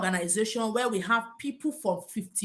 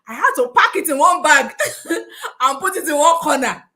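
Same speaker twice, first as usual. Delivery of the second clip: laughing; 3 semitones higher, 7 dB louder than usual. Real, not acted. Laughing at the memory.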